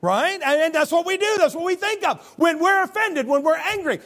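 high, loud pulpit emphasis; fiery pulpit cry; preaching loud. Acted. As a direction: hammer the point home. Preacher punches the point.

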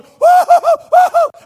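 put-on very high, loud girlish whoops; whooping cheer. Girls at the Coke machine hitting the button, shrill whooping. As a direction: squeal out excited woo-hoos. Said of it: "They're going".

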